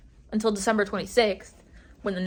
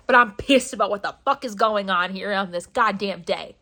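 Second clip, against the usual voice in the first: usual speaking voice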